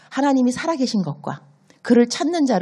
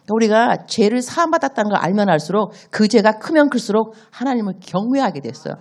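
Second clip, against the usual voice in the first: usual speaking voice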